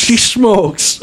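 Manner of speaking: put-on high Irish-accented "smokes" as "shmokes". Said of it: "says that things shtaste instead of taste".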